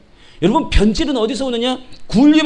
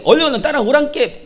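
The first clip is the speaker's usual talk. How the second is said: higher-pitched Jeolla dialect call; 5 semitones higher than usual. Acted. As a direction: imitate a shepherd calling in Jeolla accent. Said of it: "전라도 양들한테". (목청 높인 전라도 사투리)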